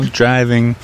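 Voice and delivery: ordinary talk, nothing marked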